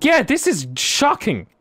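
high, drawn-out complaint; indignant protest. Real, not acted. Losing host answers co-host's claim to win, indignant. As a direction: stretch out the outrage, pitch way up. Sore loser protests the result.

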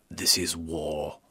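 slow posh English This is war with the R on war; posh English, R sounded. Shown as a demonstration. Actor shows how the accent coach wanted war said. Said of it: "I put on the R at the end".

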